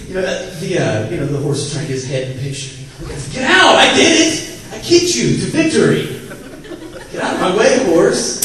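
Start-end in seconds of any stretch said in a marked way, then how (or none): 3.3-8.4 high, loud, bossy shouting; hammed-up triumphant jockey; jockey yelling. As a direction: shove the horse aside with your voice.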